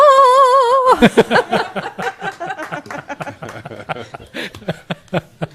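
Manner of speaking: big burst of laughter; loud laugh. Real, not acted. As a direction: laugh hard at yourself. Laughing after her performance.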